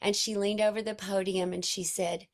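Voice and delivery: ordinary talk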